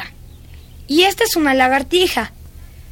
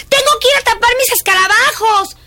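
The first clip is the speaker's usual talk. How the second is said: sudden urgency; 7 semitones higher, 5 dB louder than usual. Acted. Child alarmed about rain.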